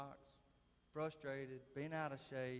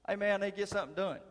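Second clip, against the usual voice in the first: mock demand; 6 semitones higher, 12 dB louder than usual. Boss pulls rank, quoted loudly.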